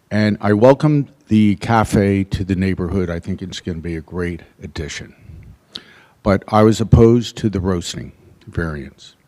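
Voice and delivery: normal talking voice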